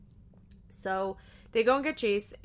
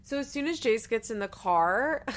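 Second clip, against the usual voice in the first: normal talking voice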